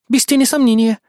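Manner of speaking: high, firm female-character reply; confident. A narrator's reading. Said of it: "уверенно заявила она".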